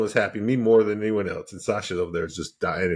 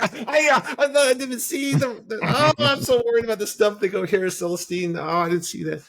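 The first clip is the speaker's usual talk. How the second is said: high, loud flustered stammering; a player caught missing the objective, stammering in a high panic; mock panicked stammer; 4 dB louder than usual. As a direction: squeak it out like a caught-out kid. Retold himself flustered after losing.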